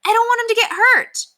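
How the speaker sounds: put-on a young boy explaining, upset; boy pleading. A narrator's reading.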